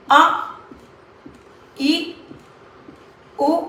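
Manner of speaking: अ, ई, उ each cut to a single beat; brief isolated vowel sounds अ, ई, उ; short vowels. Shown as a demonstration. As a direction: say अ, ई, उ as quick, separate short vowels. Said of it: "एक पल में होता है".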